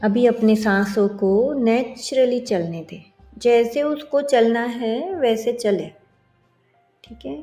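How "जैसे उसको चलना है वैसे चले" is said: ordinary delivery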